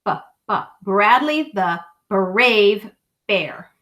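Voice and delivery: slow, leaning on each B in "Bradley the brave bear"; stressed B sounds. Shown as a demonstration. Reading to a child, stressing the letter B. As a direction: spell out the B in "B-, B-, Bradley". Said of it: "start with the letter B".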